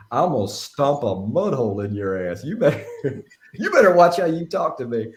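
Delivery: mock Southern threat, rising high and loud. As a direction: threaten like a Southern prank caller. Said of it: "got this real Southern accent".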